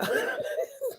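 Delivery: a high burst of giggling; high giggle. Real, not acted. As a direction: giggle before the punchline. Bursting into laughter.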